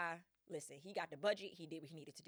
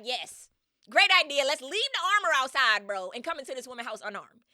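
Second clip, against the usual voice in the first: loud, higher sarcastic mock of the men; mocking loud quote; 5 semitones higher, 15 dB louder than usual. Mocking Odysseus's careless men, loud and sarcastic. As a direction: sneer it out like a dumb suggestion. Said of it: "Odysseus would have never been like".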